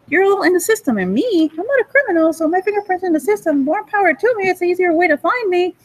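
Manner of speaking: high-pitched, insistent mock argument; acted arguing. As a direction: argue the case way up high.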